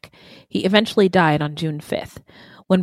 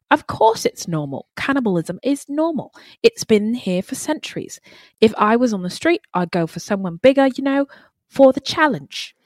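killer's lines in a lilting British accent; 4 semitones higher than usual. Shown as a demonstration. Co-host reading a killer's reply on request, in a British accent. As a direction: read it as a matter-of-fact Brit. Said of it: "try it again in a British accent".

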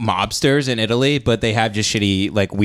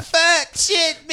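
high strained cry of pain; pained yell; slower than usual. Acted. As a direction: yell it like you're gutted. Dying soldier screaming in pain.

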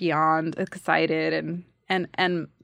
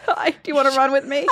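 high, quick and loud through a laugh; mock perky invitation; 6 semitones higher, 6 dB louder, faster than usual. Actor mocking herself inviting dates to run, laughing. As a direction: laughingly fake a hopeful pick-up line.

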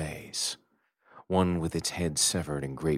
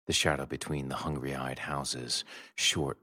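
ordinary talk, nothing marked

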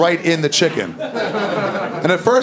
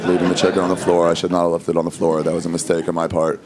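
much lower, small, grovelling apology voice; meek apology; 10 semitones lower than usual. Pretend. Husband apologizing to his angry wife, meek and grovelling. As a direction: grovel like a scolded husband, over-polite. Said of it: "you'd have to be like".

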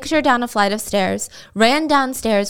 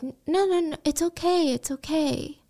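soothing victim; 6 semitones higher, 5 dB quieter than usual. Retold or imitated. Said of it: "be like".

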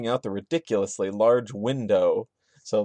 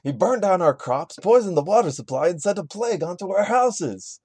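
higher, rising distressed cry; dramatic lament; 6 semitones higher than usual. Acted. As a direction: wail it like a wronged villager. Voicing victim lament.

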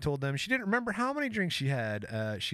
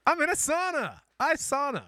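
put-on high, louder teasing Scandinavian accent; mock Scandinavian accent; 13 semitones higher, 4 dB louder than usual. Co-host teasing his friend's sauna bit, high mock Scandinavian accent. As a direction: mimic the sauna line in a pitched-up Scandinavian accent. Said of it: "especially with that accent".

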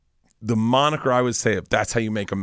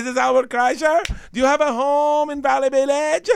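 caller voice. Retold or imitated.

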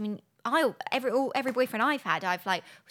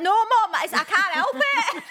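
higher, louder, laughing Manchester plea; Mancunian accent; 10 semitones higher, 7 dB louder, slower than usual. Retold or imitated. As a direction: protest in accent she cannot drop. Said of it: "in a Mancunian accent".

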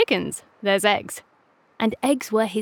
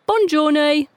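called out; 6 semitones higher, 5 dB louder than usual. A narrator's reading. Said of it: "Gus called".

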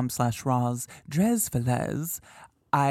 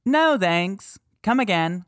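mock EVP voice; 6 semitones higher, 5 dB louder than usual. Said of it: "Is it C".

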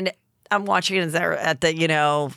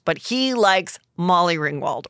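usual speaking voice

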